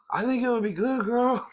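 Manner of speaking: squeaky high insistence; high playful voice. Pretend. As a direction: pitch way up, teasing.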